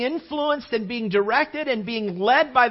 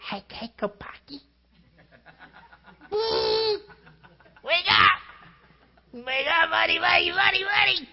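put-on gently waking a child; 7 semitones higher than usual. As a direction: coax a sleeping kid awake, playful. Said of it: "go into my son's room to wake him up".

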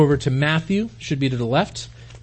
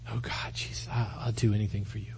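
hushed, low, near-whispered promise; humbled devotion; 6 semitones lower, 13 dB quieter than usual. Acted. Peter promising Jesus anything, hushed and humble.

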